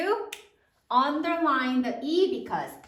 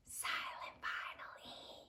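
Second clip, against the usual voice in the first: whispered; 19 dB quieter than usual. Shown as a demonstration. Teacher sounding out "share" for the class, whispered. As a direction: whisper the silent letter. Spelling out the silent letter.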